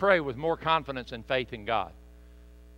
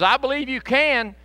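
pleading father; 7 semitones higher, 3 dB louder than usual. Retold or imitated. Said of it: "And the guy says".

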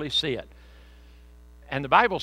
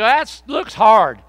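loud, slow, higher reluctant whine; friend answering; 7 semitones higher, 15 dB louder, much slower than usual. Pretend. Friend on the broad road answering back, loud reluctant whine. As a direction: call back a lazy complaint. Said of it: "And they go".

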